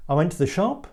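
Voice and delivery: statement turned question, rising on "shop"; questioning rise. Shown as a demonstration. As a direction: lift "shop" high as a question. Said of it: "can turn any word or sentence into a question".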